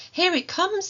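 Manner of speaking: put-on a small boy spotting the train; excited child. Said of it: "said Toby".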